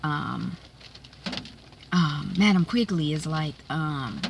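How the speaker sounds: usual speaking voice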